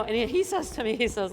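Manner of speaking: ordinary talk, nothing marked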